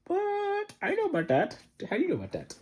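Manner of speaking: high, startled squeak of surprise; shocked. Retold or imitated. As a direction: gasp it out in disbelief. Darnay shocked by doctor's story.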